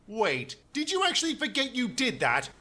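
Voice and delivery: ordinary talk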